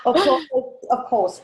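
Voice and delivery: ordinary talk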